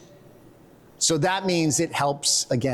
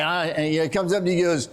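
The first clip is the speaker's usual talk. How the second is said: normal talking voice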